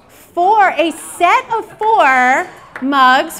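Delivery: loud and slow; big reveal. Real, not acted. Showing the audience the surprise mugs, loud and slow. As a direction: announce it like a prize.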